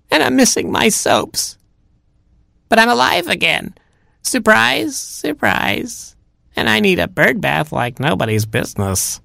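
put-on very high old bird voice; fussy Grandma Robin. Rescued Grandma grumbles to Robin, high fussy granny voice. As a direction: list the woes up high, then bustle homeward.

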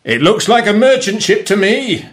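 high, carrying call; excited pirate captain calling out; captain's shout. A narrator's reading. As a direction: shout the sighting across the deck. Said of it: "he shouted".

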